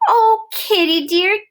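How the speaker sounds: high, loud, slow pleading call; begging the cat to stay, calling high and loud; calling after the cat. Acted. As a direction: shout after the runaway cat. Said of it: "we shouted".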